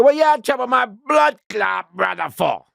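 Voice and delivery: high, loud, aggressive challenge; menacing gang voice. Retold or imitated. Gang member accuses him over his brother, loud high challenge. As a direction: confront him like a yard bully. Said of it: "he go".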